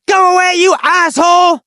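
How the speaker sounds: high, indignant mock shout; angry. Homeless people send Tony away, angry acted shout. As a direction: yell at him to get lost. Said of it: "the homeless people also got angry".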